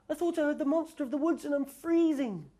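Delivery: very high little mouse voice; squeaky mouse. Acted. Freezing Mouse begging Owl, squeaky and very high. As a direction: pipe it up like a tiny cold mouse.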